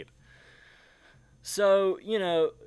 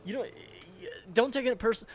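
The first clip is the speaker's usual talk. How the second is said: usual speaking voice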